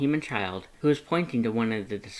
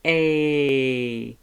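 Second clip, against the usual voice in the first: playful Frisk punchline; 3 semitones higher, 6 dB louder than usual. Acted. Frisk finger guns.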